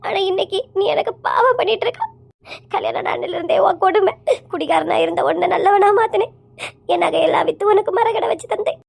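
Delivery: crying reproach, breaking between phrases; tearful lament. Acted. Wife lamenting her husband's betrayal after years of sacrifice, tearful lament. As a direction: pour out the grievance through acted sobs. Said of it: "எதுக்கும்மா இப்படி அழுதுட்டு இருக்கிறியா".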